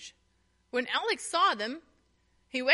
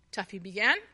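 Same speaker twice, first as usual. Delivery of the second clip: usual speaking voice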